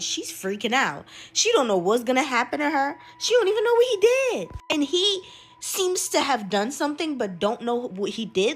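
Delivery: usual speaking voice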